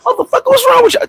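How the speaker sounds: very high squeaky outrage; mock outrage. Friend baffled by his sudden sweetness, squeaky mock outrage. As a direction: play the baffled friend shrieking.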